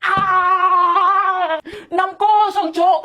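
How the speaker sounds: high-pitched wail at the score; dismayed supporter's cry; horrified outcry. Acted. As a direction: exclaim in loud despair. Horrified at six goals conceded.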